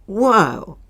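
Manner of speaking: ordinary talk, nothing marked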